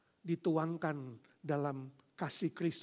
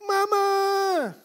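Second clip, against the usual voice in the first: high, loud cry for mama; frightened child calling out; scared child scream; 13 dB louder than usual. Retold or imitated. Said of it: "Langsung anak itu teriak".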